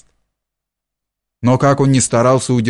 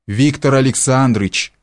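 ordinary delivery, nothing marked

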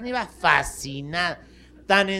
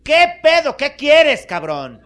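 high, loud snapping demand; annoyed bird; 10 semitones higher, 14 dB louder, slower than usual. Acted. Bird annoyed at the monkey knocking again, loud high snap. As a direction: bark it at the pest at the door.

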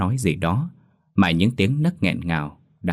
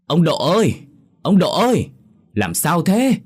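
worried calling out; 10 semitones higher, 5 dB louder than usual. A narrator's reading. Neighbour calling to Mr. Độ through the gate, anxious loud call. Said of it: "lo lắng gọi to".

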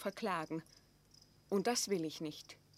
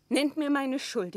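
defiant demand; 4 semitones higher, 7 dB louder than usual. Acted. Maria defiant before Elizabeth.

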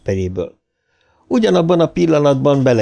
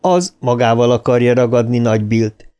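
ordinary delivery

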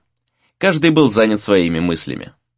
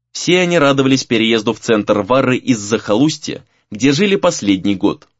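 plain reading voice

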